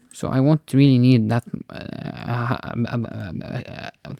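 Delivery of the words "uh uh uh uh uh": stuck on uh, stuttering; real stutter, can't get it out. Real, not acted. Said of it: "whoa, I'm stuttering".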